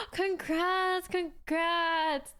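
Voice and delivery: gasp, then bright high congrats; thrilled for a viewer's new bowl; excited congrats. Real, not acted. Streamer gasps and congratulates a viewer on a new matcha bowl, excited.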